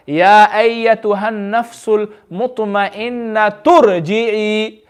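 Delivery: drawn-out, slow recitation linking the verses; slow Quran recitation. Shown as a demonstration. Teacher recites the joined verses slowly for the class. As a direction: recite it very slowly, joining the two ayat. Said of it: "when we join the two ayah together".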